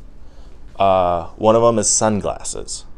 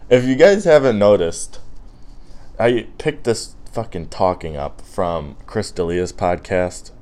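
usual speaking voice

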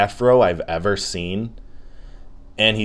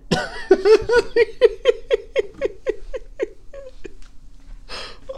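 very high, long wheezy laughing fit; hysterical laughter. Real, not acted. Podcaster mocking the outfit, breaks into high helpless laughter. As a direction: lose it completely at your own joke. Cracks up at own joke.

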